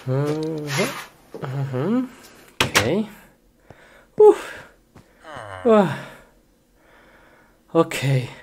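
ordinary delivery

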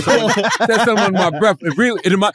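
normal talking voice

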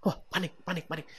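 quiet panicky aside; mock panic. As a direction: murmur a small panic to yourself. Acts out panicking under breath.

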